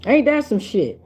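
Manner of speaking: ordinary delivery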